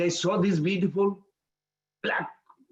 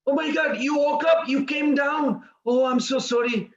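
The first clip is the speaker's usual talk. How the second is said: high, fussing apology; acting out a mother rushing to her child, pitched up; flustered mother; 7 semitones higher than usual. Mother rushes to waking son.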